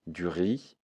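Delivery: ordinary talk, nothing marked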